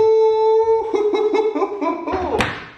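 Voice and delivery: high bursting laugh; delighted laugh. Real, not acted. Pleased laugh after test.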